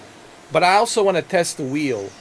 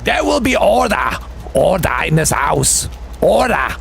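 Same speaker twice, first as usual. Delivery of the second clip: shouting order order; 5 dB louder than usual. Pretend. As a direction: call the house to order like the Speaker. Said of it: "I sound like a speaker of the House of the Lords".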